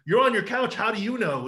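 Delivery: high, loud mock accusation; confronting critic. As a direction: mimic someone coming at you. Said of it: "when people come at me".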